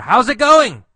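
high, loud shout to her; yelled greeting. Acted. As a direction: holler it after someone walking away. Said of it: "So he yells to her".

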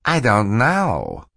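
false Stefano. Acted. Stefano lies to Mister Poe, slow and mannered. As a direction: plead ignorance with put-on innocence.

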